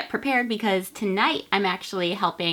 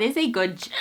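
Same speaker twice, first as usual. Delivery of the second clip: hiccups; 4 dB quieter than usual. Real, not acted. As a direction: cut off by a hiccup. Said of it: "these hiccups".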